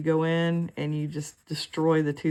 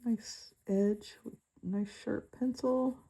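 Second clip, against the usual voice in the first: slow whispered aside; 5 semitones higher, 6 dB quieter, slower than usual. Real, not acted. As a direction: whisper it to yourself. Quiet aside while drawing.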